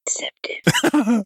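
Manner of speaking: slow, eerie whisper of "Inceptive". Retold or imitated. Repeating co-host's spooky word to the others, eerie whisper. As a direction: breathe "Inceptive" out slowly and eerily. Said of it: "a little eerie the way he said".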